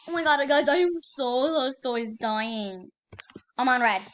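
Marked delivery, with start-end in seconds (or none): none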